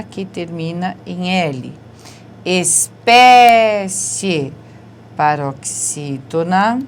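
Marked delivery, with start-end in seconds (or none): 2.4-4.5 Espécie stretched very slowly and loud; drawn-out word. Shown as a demonstration. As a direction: draw Espécie out, syllable by syllable. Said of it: "teacher spells out the word". (Espécie bem devagar e forte)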